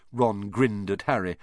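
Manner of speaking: usual speaking voice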